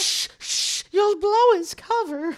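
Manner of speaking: whispered hush then high loud warning; a mother hushing her child. Acted.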